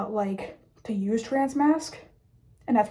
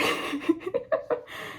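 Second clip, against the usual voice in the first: high, airy burst of laughter; breathy laugh; 7 semitones higher, 6 dB quieter than usual. Real, not acted. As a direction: let a breathy laugh slip out. Laughs at own poll result.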